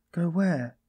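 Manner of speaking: high-pitched timid query; timid question. A narrator's reading. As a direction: ask it timidly, pitch lifted. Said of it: "asked Sonia timidly".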